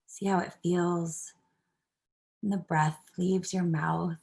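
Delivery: calm, unhurried guiding voice with long pauses; guided meditation. Acted. Instructor leading a stress reduction class through breathing, calm meditation voice. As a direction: ease them gently into following the breath.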